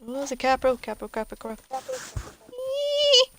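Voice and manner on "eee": squeal. Real, not acted. Startled squeal in game.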